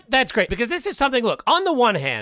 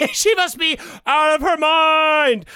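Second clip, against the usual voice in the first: outraged; 7 semitones higher, slower than usual. Acted. Indignant on friend's behalf.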